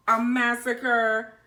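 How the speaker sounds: a sudden loud, high shout on the last word; jump-scare shout. Acted. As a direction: blast the last word to make them jump. Punchline of the campfire ghost story.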